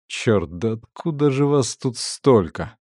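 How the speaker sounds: ordinary delivery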